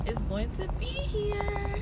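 high, drawn-out squeal of joy; excited singsong. Real, not acted. Expectant mother thrilled about her baby, excited high singsong. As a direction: draw it out high and giddy.

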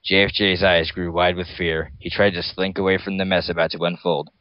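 ordinary delivery, nothing marked

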